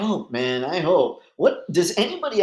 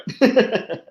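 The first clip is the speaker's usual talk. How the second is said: laughing; 9 semitones higher, 3 dB louder than usual. Real, not acted. Laughs at own joke.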